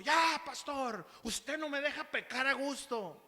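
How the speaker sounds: imitating a cranky congregant, high and loud; mock an annoyed man griping at his pastor; hammed-up angry whine, slow at first; angry complaint. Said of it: "bien enojado me dijo".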